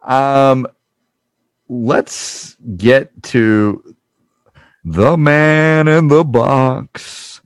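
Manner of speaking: drawn-out game show announcer; slow dramatic announcer. Pretend. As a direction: stretch it out like a TV host. Dramatic announcer intro of contestant.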